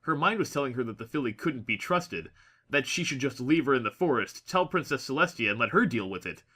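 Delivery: usual speaking voice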